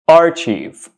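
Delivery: archive said as AR-cheev; mispronounced archive. Shown as a demonstration. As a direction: say "archive" with CH and a long EE. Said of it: "which is completely wrong".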